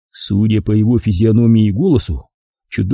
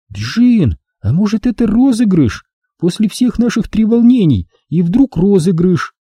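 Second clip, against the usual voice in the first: higher, lighter woman's voice; young woman, uneasy; Angela's voice; 10 semitones higher than usual. Acted. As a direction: voice the worried girlfriend. Angela's voice in narration.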